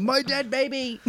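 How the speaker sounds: high, drawn-out mock cry; slow, high hammed-up lament; cartoon catchphrase parody. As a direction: stretch it into a cartoon wail.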